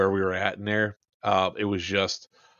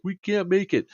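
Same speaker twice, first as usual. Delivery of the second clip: higher, whiny imitation; whiny mock teammates; 9 semitones higher than usual. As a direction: mimic the scared teammates. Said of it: "they're like".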